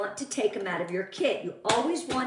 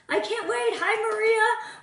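excited; 10 semitones higher, 3 dB louder than usual. Real, not acted. Excited about spring catalog.

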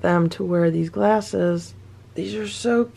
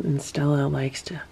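soft whisper so the cat stays asleep; hushed whisper; 4 semitones lower, 8 dB quieter than usual. Real, not acted. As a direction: whisper it to keep quiet.

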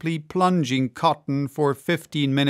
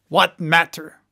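lightly raised-voice outburst; crying out; 4 dB louder than usual. A narrator's reading. Maston brushes off the expense, mildly crying out. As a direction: toss it out as a mild cry. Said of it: "cried J T Maston".

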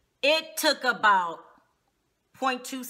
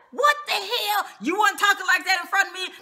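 mock rage; 9 semitones higher, 4 dB louder, faster than usual. Tammy flies into a rage.

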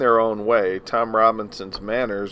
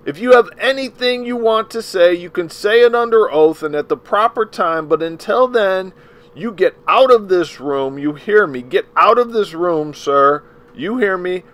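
much higher and much louder, a furious tirade; angry yell; 10 semitones higher, 8 dB louder than usual. Acted. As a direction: throw him out of the room, raging. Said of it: "he yelled".

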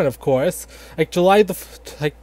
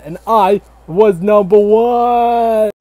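slow, loud, higher victory shout; triumphant shout; 4 semitones higher, 4 dB louder, slower than usual. Acted. George Washington shouting his victory to the crowd, slow triumphant shout. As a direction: bellow the boast to the crowd.

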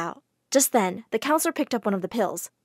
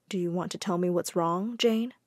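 lower, soft concerned question; gentle; 4 semitones lower, 6 dB quieter than usual. Acted. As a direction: ask tenderly. Widow gently asks.

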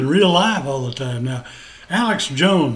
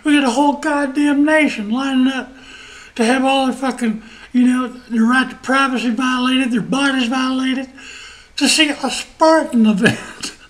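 raised, louder outraged disbelief; imitated near-breakdown; 6 semitones higher, 4 dB louder than usual. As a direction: mimic someone cracking up in exasperation. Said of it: "gonna have a mental fucking breakdown".

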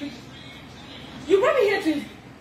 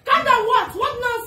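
high, raised voice throwing it back; indignant outburst; 5 semitones higher, 4 dB louder than usual. Real, not acted. Accused woman answering the man calming her, indignant outburst. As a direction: fire back in outrage.

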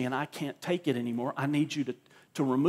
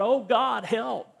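mock pleading; 8 semitones higher, 4 dB louder, slower than usual. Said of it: "no more begging and pleading with God".

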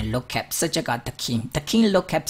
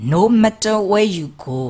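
"No matter where you go" slow, louder and higher; emphatic model phrase; 8 semitones higher, 6 dB louder, much slower than usual. Shown as a demonstration. Teacher models the phrase for learners, slow, loud and high. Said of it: "no matter where you go လို့သုံးတယ်".

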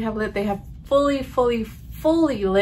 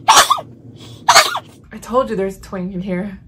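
slow and louder, pressing the point; insistent told-you-so; 7 dB louder, slower than usual. Real, not acted. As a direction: insist you were right all along. Insists on the twang.

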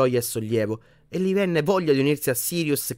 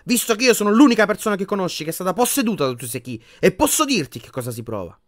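much higher and louder telling-off; angry; 8 semitones higher, 7 dB louder than usual. A narrator's reading. Ginny scolding Harry for hiding, angry. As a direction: scold him hard. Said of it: "replicò Ginny arrabbiata".

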